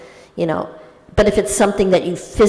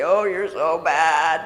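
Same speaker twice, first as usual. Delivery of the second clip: low, slow scolding inner voice; pain persona; 4 semitones lower, 4 dB quieter than usual. Acted. Pain persona scolding the ego after an apology, low and reproachful. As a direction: draw out the reproach in a lowered voice. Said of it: "the pain persona is gonna say".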